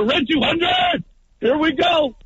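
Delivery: high, drawn-out quarterback holler; shouted play call. Retold or imitated. Quarterback calling red two hundred at the line, shouting.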